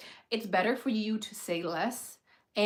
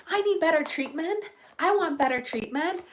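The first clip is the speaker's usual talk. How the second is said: mock demanding whine; 7 semitones higher, 4 dB louder than usual. Mocking demanding neurodivergent person.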